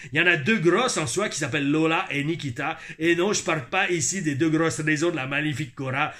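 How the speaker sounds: put-on struggling Quebec accent with rolled Rs. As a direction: read emphatically as a hammed-up Québécois. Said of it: "j'ai du mal avec les airs, avec l'accent québécois". (accent québécois exagéré)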